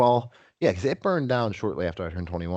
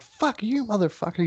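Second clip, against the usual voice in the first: much higher-pitched retold outburst; mock a drunk friend squaring up; high, indignant acted comeback; imitated angry defiance; 14 semitones higher than usual. Retold drunken standoff with owner.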